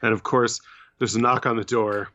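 normal talking voice

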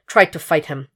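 ordinary delivery, nothing marked

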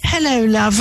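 put-on British-style accent; mock British-Australian accent. Mocking a Filipino poser's accent, put-on British or Australian. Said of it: "when you get one of us saying".

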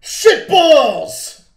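high, booming yell; full-volume playful holler; loud shout. Shown as a demonstration. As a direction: belt it out as loud as you can. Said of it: "Why was it louder when I did it".

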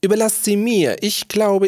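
high-pitched, shrill jester voice. A narrator's reading. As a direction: cry out high over the din. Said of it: "kreischte jetzt Topfrosch auf mit seiner schrillen Stimme". (mit hoher, schriller Stimme kreischend)

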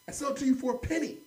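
high-pitched haggling offer; pushy vendor. Retold or imitated. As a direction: imitate an insistent street seller. Acted haggling with pushy street vendor.

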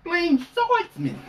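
high, slow, loud card call; dramatic anime call. Pretend. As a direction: shout the card name like a duelist. Card name called dramatically.

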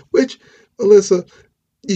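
high, loud call to Alyssa; exasperated call-out. Real, not acted. Calling out Alyssa.

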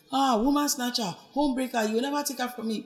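high, hammed-up outraged cry; dramatic mock accusation. Ex storming into the office, dramatic high accusation. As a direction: ham up the accusation, pitched high. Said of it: "being all dramatic and everything".

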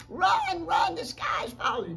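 high, loud, drawn-out warning; alarmed shout. Acted. Animals warn the turkey to run, shouting.

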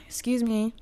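ordinary talk

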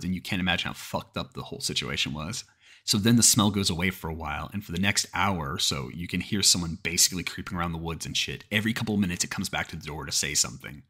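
usual speaking voice

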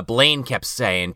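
normal talking voice

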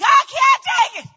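high, very loud mock wail; agonized scream. Woman in labor arriving at hospital, acted scream. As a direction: cry out in hammed-up agony.

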